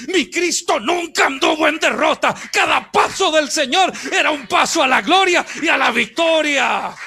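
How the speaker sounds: loud, driving sermon climax; preaching shout. Real, not acted. Preacher proclaiming Christ's victory, loud and driving.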